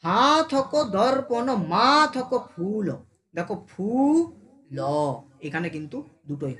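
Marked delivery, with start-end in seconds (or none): 0.0-2.9 slow, long "হা" and "মা" before "থক"; drawn-out syllables. Shown as a demonstration. Teacher showing what gets stretched, vowels drawn out. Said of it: "এটাকে টানছি". 3.7-4.8 "ফু" held long and rising in "ফুল"; drawn-out syllable. Shown as a demonstration. Teacher demonstrating an either-way syllable, "ফু" drawn out. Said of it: "এটাকে টানছি".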